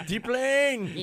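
Skipper yelled high in a mock Korean accent. Shown as a demonstration. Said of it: "can you imagine with a Korean accent, Gilligan yelling".

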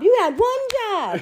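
mother retelling how she scolded her daughter; mock exasperated scold. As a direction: stretch it out in mock disbelief.

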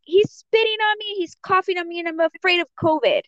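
high-pitched mock ranting; imitated angry complaint. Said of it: "yells at me".

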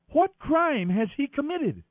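high, slow, insistent question; demanding. A narrator's reading. Pilate challenges the crowd, demanding a reason. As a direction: press for an answer, pitched high and drawn out. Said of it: "Pilate demanded".

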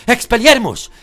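shouted spell; high, very loud yell; loud exclamation. A narrator's reading. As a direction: cry the spell out at full force. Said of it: "exclamó Harry".

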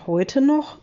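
startled disbelief at the short notice; surprised. A narrator's reading. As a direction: caught off guard, voice jumps up. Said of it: "fragt Holger überrascht".

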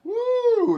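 high, loud "woo"; exaggerated whoop. Questioner hears witness came from Portland, Maine, loud mock-amazed whoop. Exaggerated reaction to long distance.

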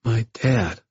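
put-on mildly wistful young boy; aching. A narrator's reading. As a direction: let a slight ache into it. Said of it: "I heard the ache in his voice".

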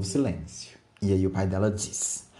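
ordinary delivery

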